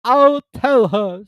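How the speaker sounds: slow, drawn-out mournful Mock Turtle; slow hollow moan; deep hollow voice. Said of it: "in a deep, hollow voice".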